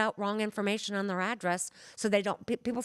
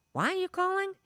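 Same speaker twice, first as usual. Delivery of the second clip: high, startled why are you calling; a relative caught off guard by the call, pitched high and startled; startled question; 12 semitones higher, faster than usual. Retold or imitated. Caller's relative asking, high and surprised.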